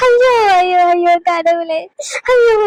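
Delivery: loud anguished wails of despair; wailing. Acted. Grandmother wails after her son scolds her, crying loudly. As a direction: cry out to God in anguish.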